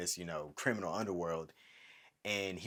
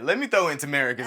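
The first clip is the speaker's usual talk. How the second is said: laughing; 6 semitones higher, 9 dB louder than usual. Retold or imitated. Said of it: "I'm like".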